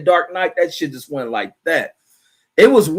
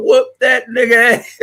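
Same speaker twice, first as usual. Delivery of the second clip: high, loud mock yell through laughter; egging on a street fight, cracking up; chuckling mock fight call; 9 semitones higher, 5 dB louder than usual. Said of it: "He talking about".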